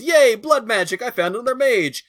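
lightly voiced, excited mage squealing; giddy Meryl. Podcaster imitating Meryl finding a mage, mock glee. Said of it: "Meryl goes off and goes".

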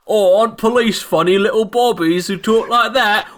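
high, loud hammed-up cockney; mock the funny little bobbies; mock bobby. Player mocking British police in a high cockney voice. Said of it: "we're just like".

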